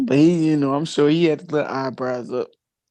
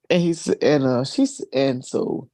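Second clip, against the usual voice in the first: usual speaking voice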